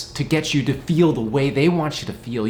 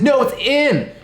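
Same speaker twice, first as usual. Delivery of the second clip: kid's retold retort; 12 semitones higher, 6 dB louder than usual. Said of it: "and he said".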